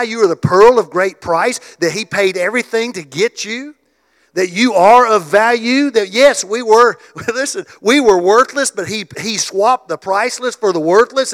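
loud, rising, driving preacher's cadence; preaching at full force. Acted. Pastor rallying the congregation, loud and fervent.

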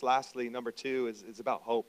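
normal talking voice

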